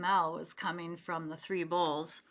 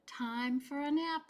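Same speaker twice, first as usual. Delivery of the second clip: sleepy thought; 6 semitones higher than usual. A narrator's reading. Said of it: "she thought".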